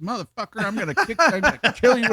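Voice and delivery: put-on pissed girlfriend threatening the man who keeps hanging up; imitating furious girlfriend. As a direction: ramp up into a hammed-up threat. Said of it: "And she's pissed".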